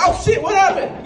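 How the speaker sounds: high, hammed-up shocked shout; startled alarm. Bystander seeing his friend struck by a baguette, hammed-up shock. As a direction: cry out in mock alarm.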